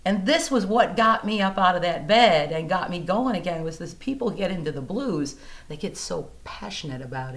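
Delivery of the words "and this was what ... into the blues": ordinary talk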